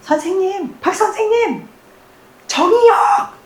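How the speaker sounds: very high, squeaky mock-student voice; students crying out. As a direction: pretend to be an eager pupil piping up, far above your own pitch. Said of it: "니들이 속으로 외쳤어야 돼".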